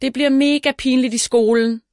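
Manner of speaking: high, distressed outburst; upset cry. A narrator's reading. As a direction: wail about the embarrassment to come. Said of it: "råber hun".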